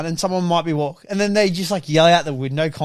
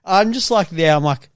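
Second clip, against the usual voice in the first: ordinary delivery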